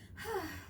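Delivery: a gentle falling sigh; soft sigh. Acted. The maid regrets cutting the top, soft sigh. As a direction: breathe out a little regret. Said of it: "sighed Missus Adelaide".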